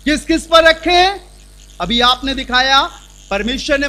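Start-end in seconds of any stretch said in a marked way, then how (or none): 0.1-1.2 loud, high call-and-response preaching; forceful preaching. Acted. Preacher quizzes the congregation, loud and emphatic. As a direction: drive the word home to the crowd.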